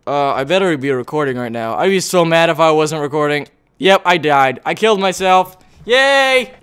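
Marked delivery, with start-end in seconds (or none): 5.8-6.5 high drawn-out cheer. Real, not acted. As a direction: cheer the end of the ordeal. Celebrating the end.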